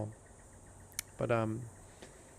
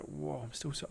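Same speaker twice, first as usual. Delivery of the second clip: hushed repeat; 5 semitones higher, 6 dB quieter than usual. Retold or imitated. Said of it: "I was, like, repeating it".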